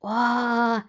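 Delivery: plain reading voice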